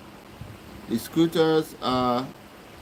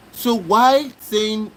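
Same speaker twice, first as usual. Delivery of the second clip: slow, higher and louder, drawn out in disbelief; exasperated; 5 semitones higher, 4 dB louder, much slower than usual. Real, not acted. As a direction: stretch it out, fed up. Exasperated at the streamer.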